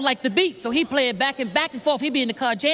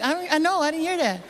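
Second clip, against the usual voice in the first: lightly voiced Michael, higher, denying; higher sheepish Michael denying he said it; Michael denial; 6 semitones higher than usual. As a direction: play innocent like Michael. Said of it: "He was like".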